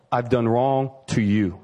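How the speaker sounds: slow, humble admission; humble confession. Retold or imitated. David confessing to God, slow and humble.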